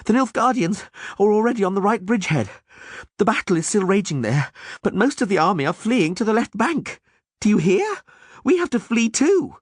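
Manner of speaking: high, breathless panic; panicked. Acted. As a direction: urge flight in a rush. Dandelion panics, urging flight.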